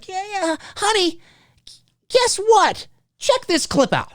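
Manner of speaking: a husband showing his wife a clip; excited husband to wife. Retold or imitated. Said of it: "go tell your wife".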